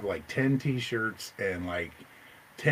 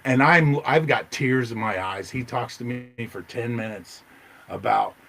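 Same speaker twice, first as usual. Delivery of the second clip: ordinary delivery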